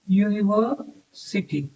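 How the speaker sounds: University slowly, split by a pause before city; slow sounding-out. Shown as a demonstration. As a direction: softly sound out university in two slow parts. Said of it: "university का sound क्या आ रहा है". (University को धीरे-धीरे, तोड़कर बोलते हुए)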